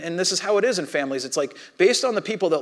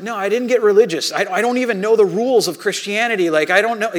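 higher, louder retold self-defence; loud protest; 5 semitones higher, 7 dB louder than usual. New believer defending himself to angry friend, loud retold protest. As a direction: push back insistently, pitch and volume up.

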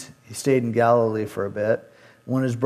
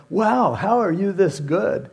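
mock amazement; 8 semitones higher, slower than usual. Crowd amazed by Jesus' teaching.

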